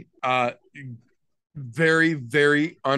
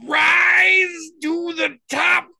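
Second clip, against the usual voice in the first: rising into a hammed-up announcer yell, much higher and louder; mock dramatic shout; 8 dB louder, slower than usual. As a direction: build to a mock-epic cry. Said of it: "I'm just fucking around".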